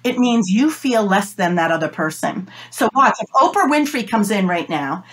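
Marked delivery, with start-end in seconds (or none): none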